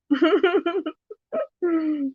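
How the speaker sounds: high giggle tailing off; amused high titter; real chuckle. Real, not acted. Amused after joking about money.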